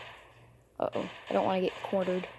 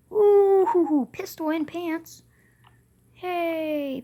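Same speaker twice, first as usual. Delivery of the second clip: excited; 8 semitones higher, 7 dB louder, much slower than usual. Real, not acted. Excited at found loot.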